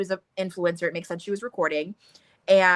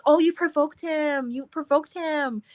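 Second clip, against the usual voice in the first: timid fretting; 7 semitones higher than usual. Retold or imitated. Timid Tess scolding friend.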